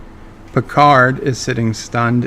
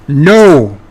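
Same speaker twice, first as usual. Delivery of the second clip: louder, sharp shouted "No"; Picard yells; 9 dB louder than usual. Acted. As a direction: stop him with one loud cry. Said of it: "yells Picard".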